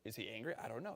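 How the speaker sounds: a frightened woman fretting; scared woman. Retold or imitated. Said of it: "And she's like".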